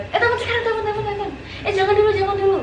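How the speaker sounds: high, rushed, laughing commands; frantic urging. Retold or imitated. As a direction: urge them on in a giggly panic. Retold frantic instructions during prank.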